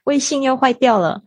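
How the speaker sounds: high, sorry little voice; apologetic friend voice. Retold or imitated. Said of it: "然后还跟我讲说".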